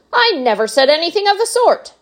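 high, loud, offended candy maker; indignant showman. Acted. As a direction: brush it off grandly. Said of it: "said Mister Wonka".

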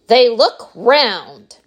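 slow, loud, emphatic contradiction; insistent. A narrator's reading. Wonka argues back at Veruca, slowly insistent. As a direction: insist on it, stretching each word. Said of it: "insisted Mister Wonka".

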